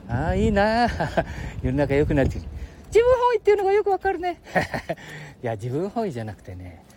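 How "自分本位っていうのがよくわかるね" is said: put-on high squeaky boy voice; cheeky little kid, much higher; Ken-chan voice.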